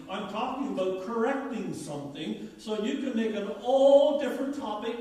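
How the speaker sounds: usual speaking voice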